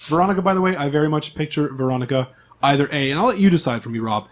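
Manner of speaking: usual speaking voice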